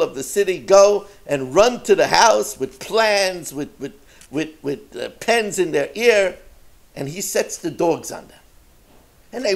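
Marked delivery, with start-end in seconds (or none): none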